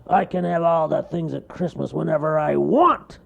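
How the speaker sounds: high, loud bellow; loud cartoon bear bellow. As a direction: call it out going off. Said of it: "he bellowed".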